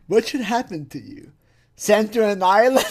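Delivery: laughing while quoting. Retold or imitated. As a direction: quote it through giggles.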